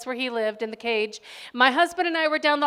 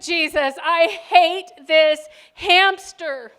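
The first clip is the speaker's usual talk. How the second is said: desperate prayer; 4 semitones higher, 9 dB louder, slower than usual. Retold or imitated. Praying in despair under covers.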